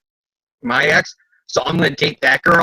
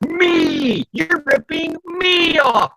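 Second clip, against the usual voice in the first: high, whiny accusing yell; whiny Tony; 13 semitones higher, much slower than usual. Retold or imitated. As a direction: whine like a cheated kid. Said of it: "Tony's turning around".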